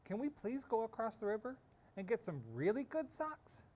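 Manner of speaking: put-on girl's voice, lifted high and hopeful; eager little girl. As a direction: ask like an excited young girl begging. Child character Tina asking.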